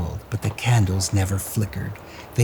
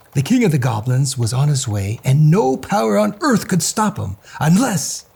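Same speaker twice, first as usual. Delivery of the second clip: plain reading voice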